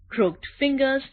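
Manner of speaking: plain reading voice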